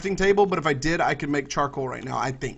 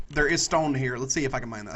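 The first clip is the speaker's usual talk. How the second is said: ordinary talk, nothing marked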